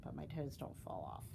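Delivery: ordinary delivery, nothing marked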